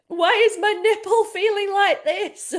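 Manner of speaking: panicked new mum. Retold or imitated. Imagining herself on Instagram asking followers, laughing mock panic. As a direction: play the flustered new mother.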